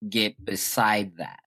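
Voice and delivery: ordinary talk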